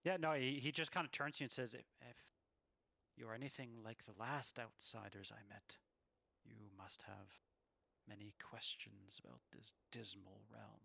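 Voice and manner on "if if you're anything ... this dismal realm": put-on soft, hushed elvish accent; a gentle elvish accent, almost murmured; Casimir's soft elvish accent. A narrator's reading. Weary wizard introducing himself to a stranger, soft elvish accent. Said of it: "in a very soft tone, but in a very elvish accent".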